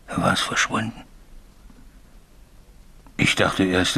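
usual speaking voice

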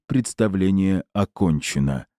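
plain reading voice

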